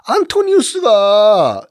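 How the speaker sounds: high, slow, drawn-out cry; hammed-up exasperated wail; mock exasperation. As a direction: stretch the name out in mock despair. Mock exasperation at poor memory.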